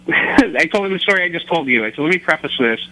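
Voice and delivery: ordinary talk, nothing marked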